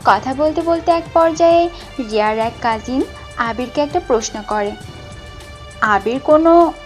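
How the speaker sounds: usual speaking voice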